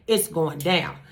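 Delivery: ordinary talk, nothing marked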